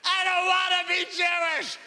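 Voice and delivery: put-on high wailing kid's protest; crying child. Small boy wails at grandfather, high and tearful. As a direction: whine it like a sobbing five-year-old. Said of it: "I start crying hysterically and I went".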